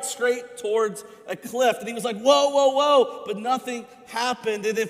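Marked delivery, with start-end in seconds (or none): 2.2-3.2 loud, high, repeated panicked whoas; panicked yells. Retold or imitated. Rider nearing the cliff, yelling in panic. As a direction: yank the reins and yell it louder. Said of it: "And so he was real panicked".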